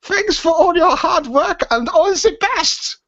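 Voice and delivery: put-on very high, slow comic German accent. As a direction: read it out in a hammed-up German accent. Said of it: "probably shouldn't do it in that accent".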